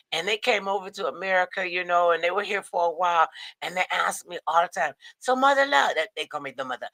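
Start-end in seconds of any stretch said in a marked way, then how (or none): 5.2-5.9 put-on higher-pitched African accent; lightly done African accent. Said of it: "maybe that was my African accent".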